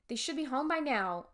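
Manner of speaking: ordinary delivery, nothing marked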